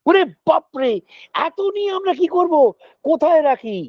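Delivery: high-pitched mock astonishment; overwhelmed children. Children receiving too much from Mother Earth, acted amazement. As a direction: exclaim like kids swamped with too much.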